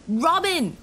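high, loud cry of her name; shouting a name. Acted. Man shouting his wife's name at the crash. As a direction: shout over him, desperate. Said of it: "I shouted over him".

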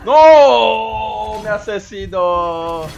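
loud shout of dismay; anguished shout. Real, not acted. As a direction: cry out as you lose. Character killed.

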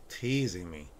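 normal talking voice

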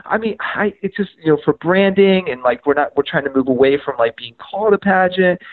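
high-pitched, halting excuse; backpedaling. Retold or imitated. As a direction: imitate someone squirming out of an awkward spot. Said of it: "just kinda backing up off of that".

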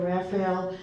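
ordinary delivery

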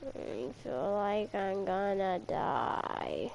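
slow, soft, drawn-out moan; sounding like dying. Pretend. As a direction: drag it out as if dying of boredom. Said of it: "You sound like you're dying".

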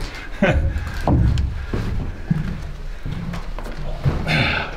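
breathless silent laughter, high; long unvoiced laugh. Real, not acted. Explorer laughing while perched on a giant toilet. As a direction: laugh without voice, helpless.

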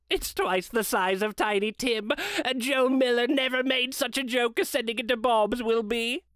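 high, delighted Scrooge chuckling over his plan; gleeful Scrooge. Acted.

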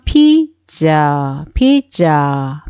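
피자 drawn out slowly, syllable by syllable; sounds joined slowly. Shown as a demonstration. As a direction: stretch both halves of 피자 for learners. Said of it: "이렇게 묶으면". (천천히 늘여 읽는 '피자')

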